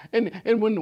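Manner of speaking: normal talking voice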